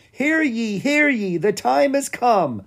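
high, loud, slow proclamation; herald's shout. A narrator's reading. Stefan announcing the hatching to the hall, loud proclaiming shout. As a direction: cry it out like a town crier. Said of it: "he shouted".

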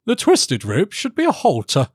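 high-pitched courtier's jibe; high, malicious interjection; spiteful courtier. Acted. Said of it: "said another courtier".

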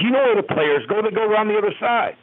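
imitating a mean dismissal, high-pitched; mean rebuff. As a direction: send him away like a nuisance. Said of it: "meanly, so to speak".

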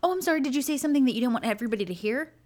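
high, quick mock-apologetic voice; mimicking a friend. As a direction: play the friend's fake innocence, fast and high. Imitating friends' reply to her protest.

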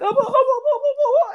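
high, frightened squeal of oh's; squealing fright. Acted.

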